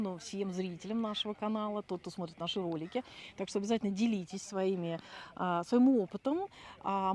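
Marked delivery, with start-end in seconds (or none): none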